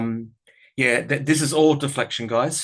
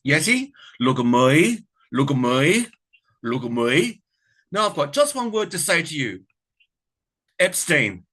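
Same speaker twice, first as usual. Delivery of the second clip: Kath voice at Yeti; 3 semitones higher, 4 dB louder than usual. Pretend. Vlogger taunting the Yeti, in a mock Kath voice. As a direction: tease him in Kath's sing-song manner. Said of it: "my take on that to the Yeti".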